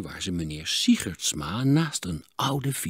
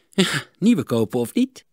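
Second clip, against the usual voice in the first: 1 grinning; 7 semitones higher, 6 dB louder than usual. A narrator's reading. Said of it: "met een grijns".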